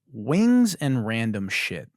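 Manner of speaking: drawn out, deadpan name; deadpan slow announcement; slow name read. Acted. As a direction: spell out the absurd name slowly. Repeating the silly name.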